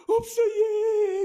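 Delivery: very slow, high, sobbing wail; crying child. Retold or imitated. Little niece answering her scolding mother, sobbing slowly and high. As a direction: wail it out through tears like a little girl. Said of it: "그러면서 막 우는 거예요".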